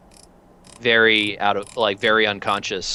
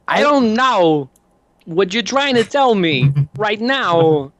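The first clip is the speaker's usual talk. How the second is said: higher, very slow, halting Walken impression; bad Christopher Walken; 9 semitones higher, 4 dB louder, slower than usual. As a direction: do a poor Walken with odd pauses. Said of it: "I don't do a very good Christopher Walken".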